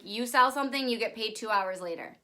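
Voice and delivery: ordinary talk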